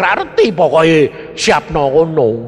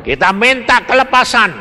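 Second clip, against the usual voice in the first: loud, rising altar call; preaching call; 5 semitones higher, 5 dB louder than usual. Acted. Preacher urging the congregation to rise, loud preaching. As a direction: rouse the crowd to stand and pray.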